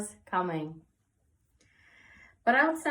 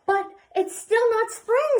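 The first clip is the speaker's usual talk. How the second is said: frustrated; 12 semitones higher, 8 dB louder than usual. A narrator's reading. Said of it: "Sophie said, and she sighed".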